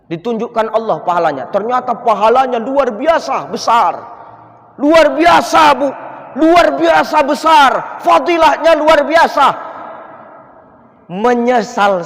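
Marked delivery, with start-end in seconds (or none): 1.5-9.5 high, loud emphatic sermon; preacher praising the reward to the congregation, loud; fiery preaching. Acted. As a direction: hammer home how great it is. Preacher stresses the great reward.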